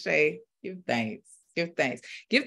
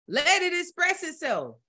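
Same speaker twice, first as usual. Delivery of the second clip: preaching; 7 semitones higher than usual. Acted. Preacher rousing the congregation in full fire. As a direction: preach it like Sunday morning in a Baptist church. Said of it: "I'm taking off the preacher hat".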